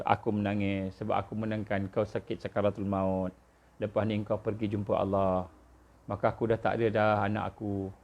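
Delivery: low, hushed monotone lament; retold tearful father. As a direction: mimic a grieving father, very low and soft. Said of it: "dia pun menangis". (tiruan ratapan bapa, rendah dan perlahan)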